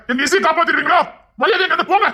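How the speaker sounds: high, indignant retort; angry challenge. Acted. Relative angrily orders uncle out.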